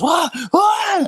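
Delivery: very high, loud hammed-up yelp of pain; the biker yelps high and loud as his hand is grabbed; mock pained cry. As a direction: squeal like a man whose arm is being crushed. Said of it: "he goes".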